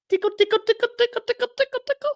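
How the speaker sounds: very high, squeaky falsetto patter; tiny tickle voice. Pretend. Intruder tickling host out of chair, playful falsetto. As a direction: chant it like quick little tickling fingers. Acting out a sneaky tickle attack.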